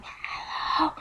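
breathy unvoiced whisper of a greeting; soft whispered hello. Shown as a demonstration. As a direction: barely breathe the word out. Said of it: "like, whisper".

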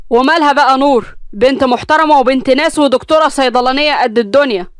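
put-on husband defending the girl, angry; irritated argument. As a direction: argue back hotly. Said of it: "حامد بنرفزة قال لها".

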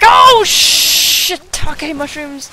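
slow, loud, high mock swear; loud, slow, high stand-in curse after the mimic; comic curse. Real, not acted. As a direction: drag it out as a silly stand-in curse.

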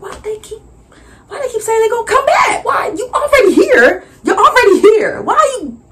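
high, loud, whiny why-though; mock despair at customers who won't buy; exasperated disbelief. Vendor whining to herself about customers.

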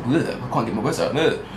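ordinary delivery

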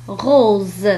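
"غوز" ending on a voiced z; French r, long o, buzzing z in "غوز". Shown as a demonstration. Teacher showing the special case, z in "غوز". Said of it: "الحالة الخاصة هو عندما ينطق كحرف".

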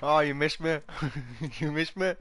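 teasing. Real, not acted. Teasing enemy who missed.

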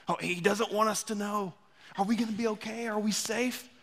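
soft, high-pitched uneasy questions; anxious passenger chatter. Acted. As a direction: lightly act out nervous passengers murmuring among themselves.

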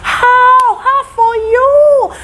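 high, loud, dragged-out pidgin cry of welcome; loud theatrical greeting from a stranger; scammer's exaggerated greeting. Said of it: "the guy was like".

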